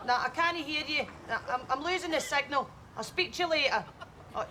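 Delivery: usual speaking voice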